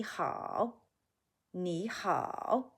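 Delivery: "hao" with a full clear dip and rise; emphasized third tone. Shown as a demonstration. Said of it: "emphasize the third tone in hao".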